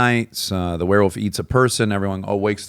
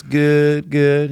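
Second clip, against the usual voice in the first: put-on higher Southern old-woman reply; 6 semitones higher than usual. Old woman answering a random caller, Southern accent. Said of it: "he calls this old woman".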